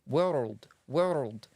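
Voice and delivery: "world" in two syllables, r trilled; Scottish trilled world. Shown as a demonstration. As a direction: attempt a Scottish "wor-rld". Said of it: "like a trill type of thing".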